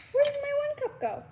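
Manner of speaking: high, fast puzzled question; suddenly baffled, voice jumping up; surprised. Real, not acted. Searching for lost cup.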